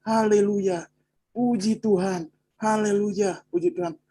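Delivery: mock praise cry held up high; imitated evangelists praising. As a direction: imitate victims calling out praise, voice lifted high. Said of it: "yang penginjil itu, Pak, bilangnya cuma apa".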